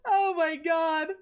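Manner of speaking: gasping it out through laughter; laughing. Real, not acted. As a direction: still cracking up as you say it. Coach still laughing at replay.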